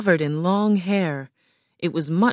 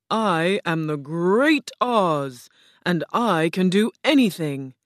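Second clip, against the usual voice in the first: lightly voiced stately enchantress announcing herself; grand Oz. A narrator's reading. Oz as green woman introduces herself, grandly slow. Oz sets her price.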